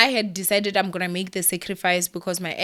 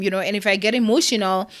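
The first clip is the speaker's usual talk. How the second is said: usual speaking voice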